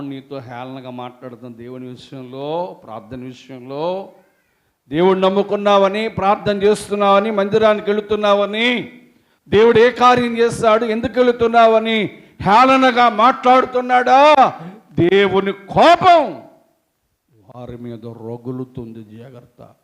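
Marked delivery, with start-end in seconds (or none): 4.9-16.5 loud, high fervent sermon voice; full-force preaching. Acted. Preacher retelling Job's friends to congregation, fervent. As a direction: preach it out with fire.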